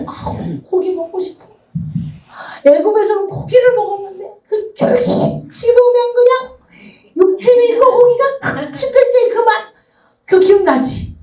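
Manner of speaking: high, longing voice of the tempter; whiny craving. Acted. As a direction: whine for the meat, dreamily. Weeds voicing a craving for meat.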